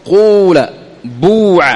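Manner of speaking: قول بوع drawn out slowly with a full rounded u; slow pure u demo. Shown as a demonstration. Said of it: "هكذا تقول".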